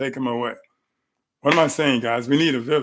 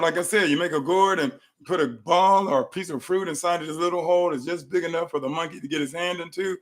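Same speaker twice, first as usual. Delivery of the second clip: ordinary talk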